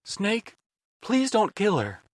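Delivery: put-on high, begging young man; pleading. A narrator's reading. Otacon begging.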